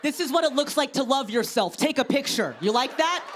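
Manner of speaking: snapping at a staring stranger, playfully; mock confrontation. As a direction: taunt the gawker, chest out. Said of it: "I'm like".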